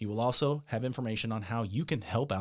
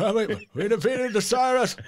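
higher, louder mock Biden voice; Joe Biden imitation; 9 semitones higher, 7 dB louder than usual. Producer topping the host's Biden impression, higher and louder. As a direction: ham up the president's stumbling delivery. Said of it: "that's your imitation of Biden".

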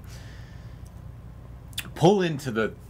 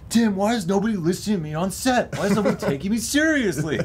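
high-pitched, worked-up self-mocking rant; out of his mind; 8 semitones higher, 3 dB louder than usual. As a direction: replay a frantic on-set complaint, hammed up. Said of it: "out of my mind".

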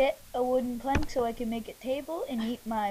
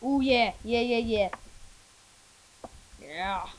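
excited; 7 dB louder than usual. Real, not acted. Excited about French toast.

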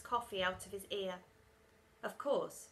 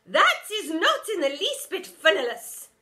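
loud, high prim reproach; offended teacher; 7 semitones higher, 12 dB louder than usual. A narrator's reading. Teacher scolding the kids, loud and prim. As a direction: sniff at the joke.

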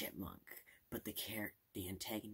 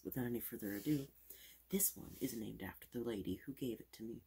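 fast aggressive asmr; 3 semitones higher, 6 dB louder than usual. Acted. Fast loud ASMR showing.

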